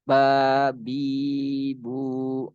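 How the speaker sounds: "Ba, bi, bu" each vowel drawn out; dibaca panjang. Shown as a demonstration. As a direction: hold BA, BI, BU long, evenly joined. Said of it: "sebab-sebab dibaca panjang". ("Ba, bi, bu" dibaca panjang)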